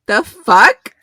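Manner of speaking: outraged loud "the fuck"; indignant outburst. Retold or imitated. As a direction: blurt it out, outraged. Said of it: "I was like".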